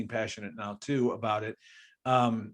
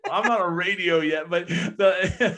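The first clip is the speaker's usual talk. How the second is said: laughing; 6 semitones higher, 5 dB louder than usual. Real, not acted. Laughing off the praise.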